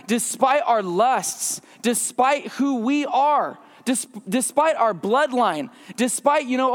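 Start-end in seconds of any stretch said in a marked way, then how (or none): none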